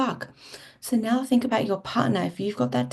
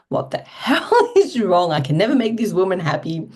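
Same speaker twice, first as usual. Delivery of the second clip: exasperated partner; 6 dB louder than usual. Retold or imitated. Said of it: "your partner's thinking".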